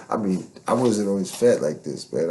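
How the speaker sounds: ordinary talk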